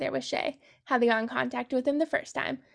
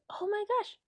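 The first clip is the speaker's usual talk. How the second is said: sudden idea; 9 semitones higher, 7 dB quieter than usual. Retold or imitated. Realizing she can tip tank.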